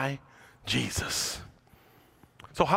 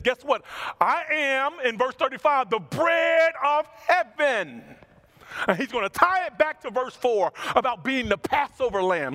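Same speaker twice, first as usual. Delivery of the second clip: preaching at full force; 9 semitones higher than usual. Acted. Pastor preaching to Overlake, fervent and raised. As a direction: drive the point home to the congregation.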